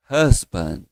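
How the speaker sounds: Husband with a light final D; model pronunciation. Shown as a demonstration. Teacher models husband for the class. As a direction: say Husband, D barely touched.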